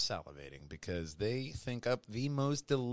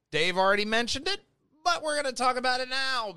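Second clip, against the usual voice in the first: fast and louder; 11 semitones higher, 6 dB louder, faster than usual. Real, not acted. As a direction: rush through excitedly. Quick excited intro.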